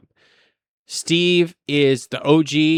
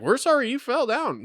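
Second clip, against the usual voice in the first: high-pitched flustered sorry, lightly imitated; high mock apology; 10 semitones higher than usual. Traveller mimicking bystanders apologizing after an old lady fell, high. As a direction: squeak out a fake-sounding apology. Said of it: "They're like".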